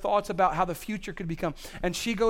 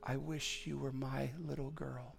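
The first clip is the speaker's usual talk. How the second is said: slow, much softer tender hush; whisper; 2 semitones lower, 10 dB quieter than usual. Retold or imitated. Teacher whispering to a little girl during a hearing test, soft and slow. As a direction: breathe it like a secret to a child. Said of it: "said in a whisper".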